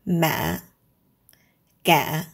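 ma and ga short and low; clipped, low ma, ga; heavy tone. Shown as a demonstration. Teacher gives heavy-tone examples. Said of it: "short and low".

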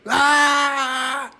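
high loud scream of panic. Retold or imitated. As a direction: let out a terrified scream. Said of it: "I screamed and I hollered".

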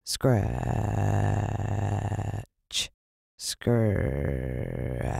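low, soft drawn-out scratch; soft scratch sound. A narrator's reading. Narrator evoking scratches in the dark, soft and low. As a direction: breathe the word like a faint scratching. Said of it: "Soft and spine-tingling".

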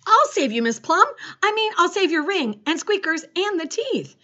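high, loud heroic promise; volunteer boldly to save the day; eager rescuer. A narrator's reading.

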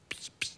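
whispered psst of a spray can; little spray-can psst noises; spray hiss. Retold or imitated. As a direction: imitate a butter spray, soft and hissy. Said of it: "It's a butter mist".

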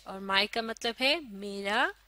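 usual speaking voice

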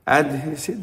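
normal talking voice

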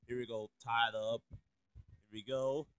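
very soft, low murmur; low muttered self-talk. Acted. Character busy tying up, muttering very low. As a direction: mutter it under your breath.

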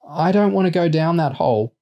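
put-on high, whiny frightened child; scared. A narrator's reading. Small boy refusing to go down the hole, scared. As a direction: plead in a small boy's high voice. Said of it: "sounding scared".

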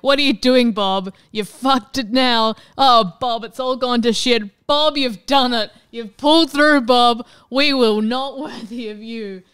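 hammed-up yelling at Bob. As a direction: holler at the screen like a fan watching a comeback. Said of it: "watching Bob Ross being like".

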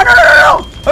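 very high, loud panicked cry; desperate shouted denial; frantic no. Real, not acted. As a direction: scream no in a rush of panic. Turkey burning, frantic.

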